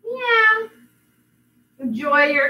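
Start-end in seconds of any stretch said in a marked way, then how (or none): 0.0-0.7 high sweet meowing; cat meow. Pretend. Teacher showing kids the cat sound, high meows. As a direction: mew like a kitten. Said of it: "No, we say".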